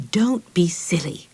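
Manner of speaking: ordinary delivery, nothing marked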